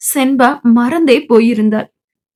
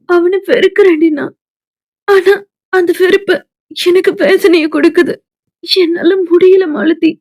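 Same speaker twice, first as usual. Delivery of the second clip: higher, with a sob breaking through; tearful confession; 4 semitones higher than usual. Acted. Senba confesses while crying.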